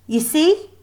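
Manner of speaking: smug, high-pitched rise; a touch of a smug schoolgirl. A narrator's reading. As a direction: show off to her friend. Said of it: "Lucretia said".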